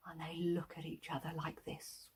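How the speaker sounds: hushed storyteller whisper; suspenseful whisper. A narrator's reading. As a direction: breathe it secretly.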